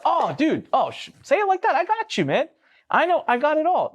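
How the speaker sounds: very high, breezy overconfidence; eager reassurance. Retold or imitated. Clueless founder assuring his new CFO about records, eager. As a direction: act out sudden comic relief, pitch way up.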